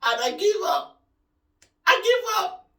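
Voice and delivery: high distressed giving up. Real, not acted. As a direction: plead that you quit. Giving up in discomfort.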